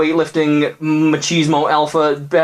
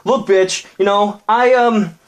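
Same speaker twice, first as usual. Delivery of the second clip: high, fumbling defensive jock; flustered boyfriend voice, pitched high; defensive bro; 8 semitones higher than usual. Acted. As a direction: stammer out excuses, higher up. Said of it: "the bro was like".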